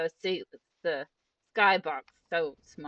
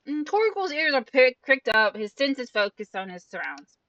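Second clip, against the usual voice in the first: higher, louder dramatic narration; projected storytelling read; story narration; 5 semitones higher, 6 dB louder than usual. Acted. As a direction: narrate the scene like a voice actor. Reads game narration aloud.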